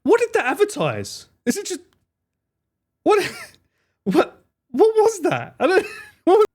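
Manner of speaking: high, incredulous laughing questions; can't believe what they just saw; baffled laughter. Real, not acted. Streamer baffled by a fruit ad, high incredulous laughing.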